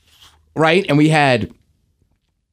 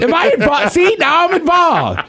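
high mock alarm; 11 semitones higher than usual. Real, not acted.

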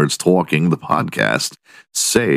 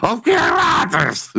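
mock excited cry. Host gushing over a country singer, mock excited. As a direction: cry out the name high, breaking into a laugh.

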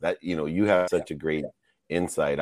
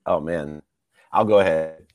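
ordinary talk

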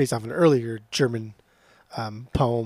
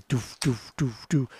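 imitated kick drum; 2 semitones lower, 6 dB quieter, slower than usual. Co-host describes the drum beat, imitating the kick. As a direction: mimic a steady bass drum by mouth. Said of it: "basic little kick drum here".

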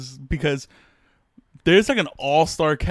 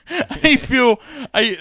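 laughing; 10 semitones higher, 6 dB louder, slower than usual. Real, not acted. Laughing mid sentence.

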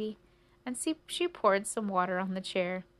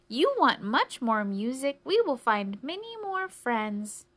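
higher, louder, emphatic offer; eager cricket mishearing; 5 semitones higher, 5 dB louder than usual. Acted. Crickets offer the mouse more friends, loudly eager.

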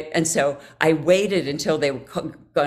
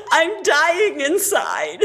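high, very slow mock-tearful strain; dying inside; 9 semitones higher, much slower than usual. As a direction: squeeze it out slowly through a pained smile. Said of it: "smiling with this look like".

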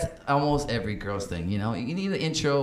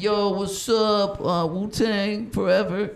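slow, drawn-out smooth talker voice; cool guy pickup line; 8 semitones higher, slower than usual. Retold or imitated. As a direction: lay on the cool act. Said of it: "And I could be like".

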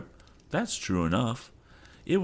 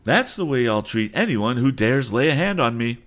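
loud, high, furious captain; angry shout; 7 semitones higher, 5 dB louder than usual. Acted. Captain who just threw a sailor overboard, angry shout. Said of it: "shouted the captain, who was transformed".

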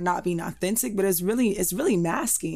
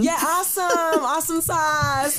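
hammed-up office Valley Girl, pitched up; 9 semitones higher than usual. Shown as a demonstration. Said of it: "use the Valley Girl voice".

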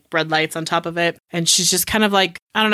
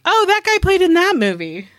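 high, louder-edged mock excitement; excited recognition; 11 semitones higher, 3 dB louder than usual. Viewer spotting a familiar actor in a movie, excited. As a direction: act out a viewer's delighted recognition.

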